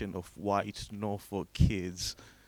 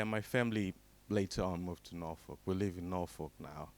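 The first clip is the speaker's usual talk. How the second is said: ordinary talk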